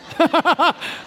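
short rapid chuckle. Real, not acted. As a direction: let out a quick run of laughter. Laughs at his own quip.

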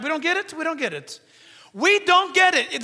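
high, loud, confused complaint; interrupting congregant. Acted. Women interrupt the service, loudly confused. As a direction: call it out over the service. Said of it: "They would interrupt the service right there".

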